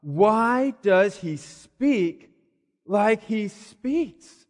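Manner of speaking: slow, drawn-out mock disbelief; mildly acted, halting astonishment; shocked question. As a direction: imitate shocked scribes, dragging out each word. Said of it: "they're shocked".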